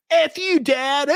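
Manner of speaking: high, whiny mock-teen outburst; hammed-up teenage angst, pitched high; angsty TV teenager. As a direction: overplay a bratty kid defying a parent. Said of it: "angst of teenage kids and turn it to ten thousand".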